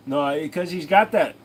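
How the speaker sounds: normal talking voice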